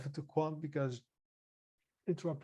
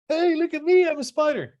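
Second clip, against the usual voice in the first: high squeaky cartoon bunny, much louder; 12 semitones higher, 13 dB louder than usual. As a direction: squeal it out like a silly cartoon rabbit. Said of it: "one bunny says to the other".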